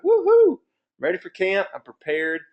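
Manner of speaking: a very high whoop, then the rest eager; pretend cheer. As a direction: cheer as a ready parent. Imagined parent cheering readiness.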